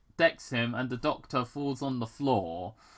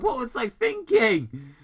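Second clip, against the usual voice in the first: high, loud, fast grumble; complaining; 11 semitones higher, 6 dB louder than usual. Acted. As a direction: whine about the plan. Sonic keeps complaining.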